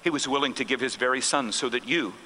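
a pastor's quiet, sincere comfort; tender. Retold or imitated. Pastor gently tells Joy God loves her.

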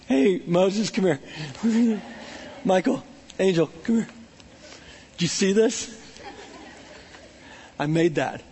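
lightly voiced God, laughing softly; a chuckling creator showing off; delighted God. God calling angels over, lightly voiced delight. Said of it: "God is watching that and he's like".

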